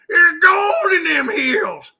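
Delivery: put-on slow, loud old prospector holler. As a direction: holler like a gold-rush miner. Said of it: "Now I sound like an old prospector".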